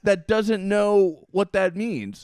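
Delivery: loud, slow, disbelieving rant; can you believe this guy, stretch it out loud; incredulous outburst. Real, not acted. Ranting to his cohost about the clueless NASA director, loud slow disbelief.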